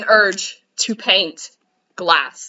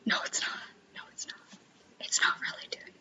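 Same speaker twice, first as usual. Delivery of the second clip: whisper; 17 dB quieter than usual. Real, not acted. Whispered admission about quilt.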